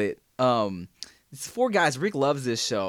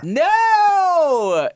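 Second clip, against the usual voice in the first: 1 emphatic no; 13 semitones higher, 6 dB louder than usual. Real, not acted. Friend keeps guessing wrong show.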